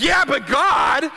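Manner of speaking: loud, slow protest; arguing with God. Retold or imitated. Arguing with God on his bed, loud slow protest. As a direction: push back hard at God. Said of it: "It's like".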